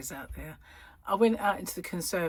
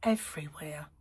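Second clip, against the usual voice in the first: normal talking voice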